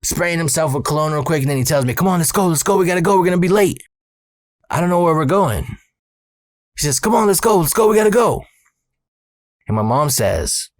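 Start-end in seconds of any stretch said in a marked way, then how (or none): 2.0-3.8 higher-pitched hurried dad impression; imitated urging. Dad hurrying his kid out to the van, imitated urging. 7.0-8.5 higher-pitched, insistent retold hurrying; imitated urging. Dad rushing his son out the door, imitated urging.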